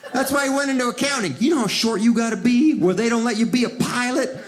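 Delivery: usual speaking voice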